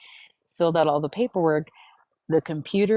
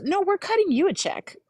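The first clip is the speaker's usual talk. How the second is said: imitating a bright, much higher cheerful clerk; advisor's voice; 14 semitones higher, 3 dB louder than usual. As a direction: chirp it like the friendly lady at the desk. Advisor's surprising news retold.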